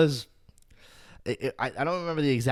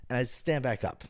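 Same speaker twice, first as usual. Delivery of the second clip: ordinary delivery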